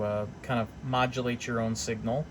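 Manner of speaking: normal talking voice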